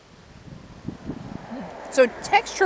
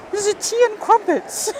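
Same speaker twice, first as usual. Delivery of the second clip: mock posh British, pitched way up; hammed-up British tea voice; goofy British accent; 13 semitones higher, 6 dB louder than usual. American vlogger goofing on British tea talk, mock British accent. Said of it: "I'm not making fun of an accent there. That's just me being goofy".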